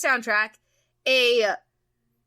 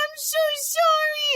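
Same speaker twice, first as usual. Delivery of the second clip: put-on girl's apology. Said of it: "She's like".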